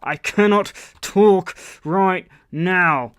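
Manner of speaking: slow, emphatic, word-by-word hint; heavy hinting. Retold or imitated. Caller hinting to his girlfriend that he can't talk, pointed. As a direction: punch and stretch each word so she gets it. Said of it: "I was like, you know".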